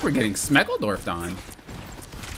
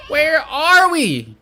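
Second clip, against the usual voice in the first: high, loud, slow drawn-out cry; exasperated yell; 14 semitones higher, 7 dB louder, much slower than usual. Real, not acted. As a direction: stretch out the words in disbelief. Frustrated gamer yells at team.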